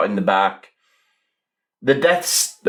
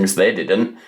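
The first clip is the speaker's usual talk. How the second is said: ordinary delivery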